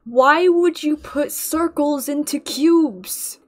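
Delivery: mock outrage.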